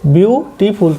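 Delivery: বিউটিফুল in three parts, বিউ-টি-ফুল; "বিউটিফুল" broken into three clear beats; syllable by syllable. Shown as a demonstration. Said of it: "তিনটা syllable আছে".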